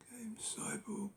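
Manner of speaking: normal talking voice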